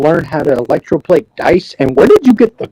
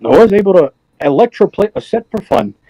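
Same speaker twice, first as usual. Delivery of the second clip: ordinary delivery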